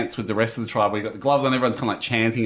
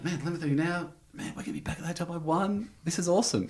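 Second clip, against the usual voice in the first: excited chatter; 6 semitones higher, 8 dB quieter, faster than usual. Retold or imitated. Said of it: "we're like".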